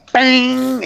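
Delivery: high, ringing "bang" sound effect; imitated impact. Game master voicing a club hitting a breastplate, high ringing bang. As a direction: sing out the clang of a blow.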